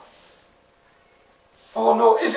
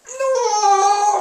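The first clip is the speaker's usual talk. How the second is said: high, wavering crying no; 13 semitones higher than usual. Real, not acted. Gamer near tears after another bad pull. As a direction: let it break into a sob.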